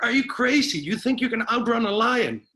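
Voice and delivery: high panicked yell at his calm friend; retold scream. Friend in the jungle screams at his calm buddy, high frantic yell. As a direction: shout it in disbelief, way up high and loud. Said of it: "the other friend is screaming at him".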